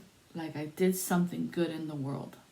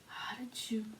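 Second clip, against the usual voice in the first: whispered then higher, stunned; shocked stammer; 9 semitones higher than usual. Retold or imitated. The woman reacting to the reading, stunned whisper. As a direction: gasp it out in disbelief.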